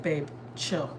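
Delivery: a soft, low hush to calm him; quiet plea. Retold or imitated. Wife quietly telling her husband to calm down, soft and low. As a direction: murmur it so nobody else hears. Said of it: "I was like".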